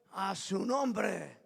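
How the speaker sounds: long, drawn-out preacher's call; congregational call. Real, not acted. Preacher calling the congregation to praise, slow drawn-out call. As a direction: stretch each word, inviting the response.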